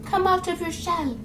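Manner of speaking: soft, high mock coaxing; coaxing outsider. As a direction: imitate a gentle, hushed lure to someone shy. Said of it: "they tend to be like".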